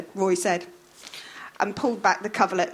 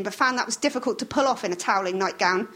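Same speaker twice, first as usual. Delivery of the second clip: ordinary delivery, nothing marked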